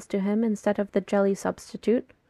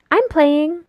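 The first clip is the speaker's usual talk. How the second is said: higher, louder little chirp; chirpy; 6 semitones higher, 7 dB louder than usual. A narrator's reading. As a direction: chirp it proudly, pitched up. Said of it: "Fiona chirps".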